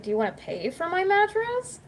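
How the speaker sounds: high, rising rhetorical question; indignant challenge. Retold or imitated. Retelling a comeback to a mattress critic, indignant. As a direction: throw it back, pitch climbing to the end.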